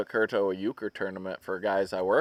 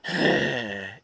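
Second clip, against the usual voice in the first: pointed, put-upon huff; kids' annoyed sigh; 3 semitones lower, 4 dB quieter than usual. Retold or imitated. Husband imitating kids asked to help, annoyed huff. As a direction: sigh like a kid given a chore. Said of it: "They do it when you're like".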